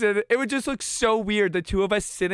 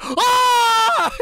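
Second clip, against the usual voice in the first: much higher, louder yelp of shock; startled scream; 14 semitones higher, 8 dB louder than usual. Retold or imitated. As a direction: scream at the crunch. Friend crushes the gecko's tail.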